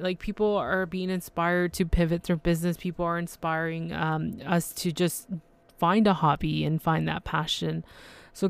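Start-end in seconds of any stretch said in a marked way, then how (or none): none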